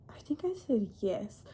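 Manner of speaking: breathy whispered aside. Real, not acted. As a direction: drop to a half-voiced whisper. Unsure aside while retelling.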